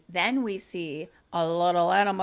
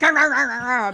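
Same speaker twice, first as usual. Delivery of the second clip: louder, higher chomping growls; mock alligator snapping; 4 semitones higher, 8 dB louder, faster than usual. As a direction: snap like a trapped alligator. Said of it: "he's just like".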